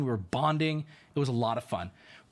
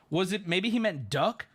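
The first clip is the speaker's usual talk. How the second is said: usual speaking voice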